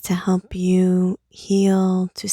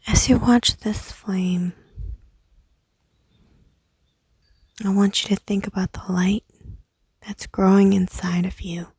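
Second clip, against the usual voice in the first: guided meditation. Acted. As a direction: whisper them into calm by the candle. Leads a candle meditation.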